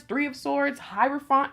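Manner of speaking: ordinary talk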